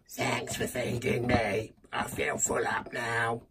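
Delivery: low, quiet character voice; voice of the bin. Pretend. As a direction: talk as a well-fed bin, low and soft. Voices the bin replying to him.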